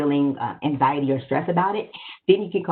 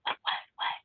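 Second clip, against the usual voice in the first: breathy unvoiced whisper, much softer; 12 dB quieter, faster than usual. Shown as a demonstration. Trainer demos whispering to a yelling person. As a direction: drop to a whisper to throw them off. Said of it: "I tend to lower my voice and I kind of whisper".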